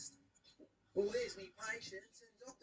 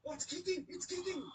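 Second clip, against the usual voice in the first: higher and louder, half-joking alarm; excited alarm; 9 semitones higher, 4 dB louder than usual. Real, not acted. Plumber to viewers as the clump moves, excited alarm. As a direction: react like the clump just moved. Drain clump seems alive.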